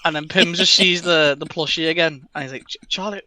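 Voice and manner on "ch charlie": much softer, hopeful question; soft touched voice. Acted. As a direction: ask tenderly, almost breathless. Pim touched by plushie.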